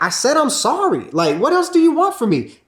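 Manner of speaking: very high, loud exasperated outburst. Retold or imitated. Husband snapping at wife, high exasperated outburst. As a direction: throw up your hands in frustration. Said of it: "And I'm like".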